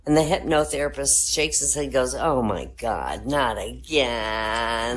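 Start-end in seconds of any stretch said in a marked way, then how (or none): 2.1-5.0 low, very slow weary groan; weary sigh. Acted. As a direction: drag it out, utterly fed up. Weary hypnotherapist.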